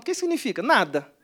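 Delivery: ordinary talk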